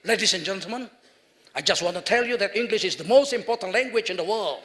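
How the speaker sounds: fluent English accent, formal speech opener. Shown as a demonstration. Preacher showing English sounds right this way, English accent. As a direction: address the hall in a smooth English accent. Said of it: "enaknya kan pakai logat Inggris".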